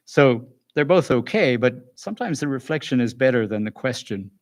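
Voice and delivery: normal talking voice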